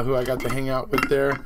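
normal talking voice

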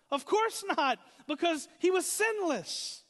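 loud, high, ringing conviction; full-force preaching. Real, not acted. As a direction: proclaim it at the top of a preacher's range.

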